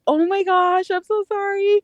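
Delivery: high-pitched imitation of an embarrassed man; mortified apology. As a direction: apologize as if blushing hard, retold. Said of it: "he just went beet red".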